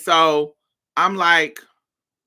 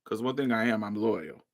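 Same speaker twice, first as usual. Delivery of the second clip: ordinary talk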